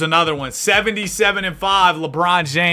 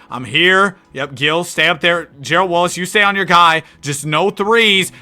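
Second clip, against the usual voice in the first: normal talking voice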